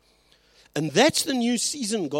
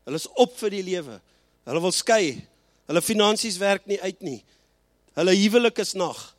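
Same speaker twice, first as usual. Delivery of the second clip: ordinary talk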